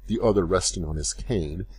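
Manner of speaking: ordinary delivery, nothing marked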